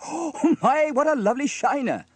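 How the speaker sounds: high, loud amazement after a gasp; shocked exclamation. A narrator's reading. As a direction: exclaim at the startling sight.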